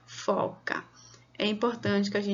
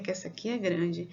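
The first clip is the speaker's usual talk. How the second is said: ordinary talk, nothing marked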